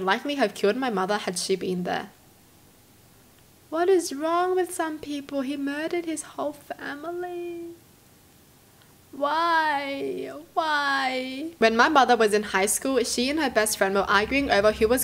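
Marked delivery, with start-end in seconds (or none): 3.7-7.7 high, slow, drawn-out dismay; appalled dismay. Real, not acted. Reader reacting to a family murder, appalled. 9.1-11.5 high, long drawn-out plaintive why; wailing dismay. Real, not acted. Reader lamenting a family murder, wailing dismay.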